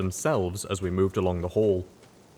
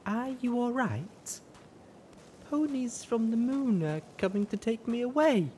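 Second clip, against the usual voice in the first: dazed voice. A narrator's reading. Strung-out mare.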